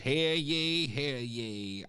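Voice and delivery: high mock town crier call. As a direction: cry it out like a herald. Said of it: "No town criers".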